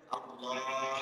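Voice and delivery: "আল্লাহ" soft and held, ending in a small h; audible final h. Shown as a demonstration. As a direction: let a breathed h close "আল্লাহ". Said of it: "একটা হা আছে না একটা?". ("আল্লাহ" আস্তে টেনে, শেষে ছোট হা স্পষ্ট)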